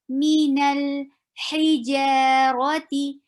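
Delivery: Minal hijarati slowly with long ee sounds; slow recitation. Shown as a demonstration. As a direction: stretch the i's in Minal hijarati. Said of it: "double E sound".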